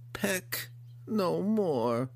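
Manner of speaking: high, very slow monster lament; grotesque monster voice. Acted. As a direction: stretch it out mournfully. Hookar repeating its lament.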